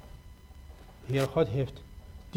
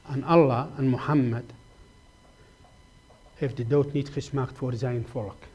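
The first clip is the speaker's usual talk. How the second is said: ordinary delivery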